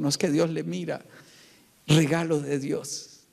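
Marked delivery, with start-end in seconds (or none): 1.9-3.2 low, weighty answer; solemn affirmation. Shown as a demonstration. Preacher answers what a daughter is, solemn affirmation. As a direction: drop deep and state it firmly.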